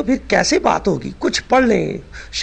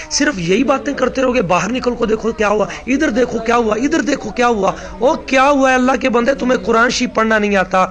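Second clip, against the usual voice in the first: higher-pitched, exasperated telling-off; heated scolding; 5 semitones higher than usual. Real, not acted. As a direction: lay into them, voice pitched up. (جھنجھلا کر ڈانٹتے ہوئے)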